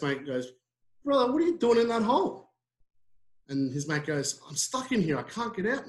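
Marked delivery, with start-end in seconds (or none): 1.0-2.5 high, loud incredulous question; acted astonishment. Best mate finding his friend stuck, acted astonishment. 4.5-5.9 high-pitched helpless plea, lightly acted; acted plaintive complaint. Stuck bloke to his best mate, acted plaintive plea.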